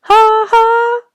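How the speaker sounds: very high, loud cawing ha-ha; crows echoing laughter. A narrator's reading. Crows echoing the laughter overhead, very high and loud. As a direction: caw the laugh back mockingly.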